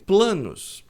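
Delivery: ordinary delivery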